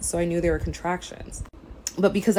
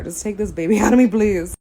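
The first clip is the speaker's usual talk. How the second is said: laughing plea; 3 semitones higher, 7 dB louder than usual. Retold or imitated. Begging for C-section.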